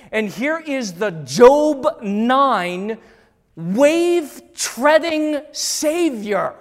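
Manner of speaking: loud, slow preacher's climax; preaching at full force. Real, not acted. Preacher revealing Jesus as Lord to the church, full-force proclamation. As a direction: proclaim it slowly, building to a ringing declaration.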